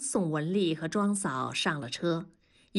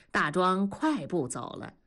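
ordinary delivery

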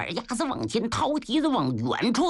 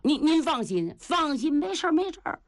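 high, offhand reassurance; breezy Niu Gao; 8 semitones higher than usual. Acted. Niu Gao brushing off his sister-in-law's worry, high and breezy. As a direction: wave her worry away, rising higher.

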